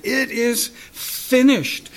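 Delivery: slow, high, emphatic cry; Jesus's triumphant cry. Acted. Preacher voices the statement's power, triumphant cry. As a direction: draw it out as a cry of victory. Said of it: "Can you hear him cry it out".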